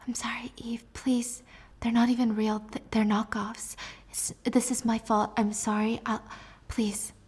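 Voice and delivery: soft, whispered begging, near tears of fear; pleading whisper. Acted. Bullied boy whispers his apology to Eve, pleading. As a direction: whisper an apology, terrified of the bully. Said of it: "So he whispers".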